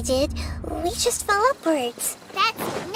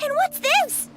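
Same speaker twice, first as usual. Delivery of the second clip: ordinary talk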